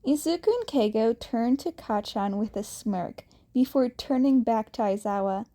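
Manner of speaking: ordinary delivery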